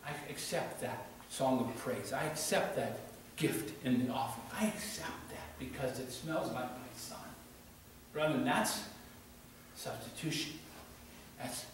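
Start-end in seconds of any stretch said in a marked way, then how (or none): 0.0-7.2 soft, hushed, gentle divine voice; voice of God. Acted. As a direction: let God speak tenderly and quietly. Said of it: "And God says".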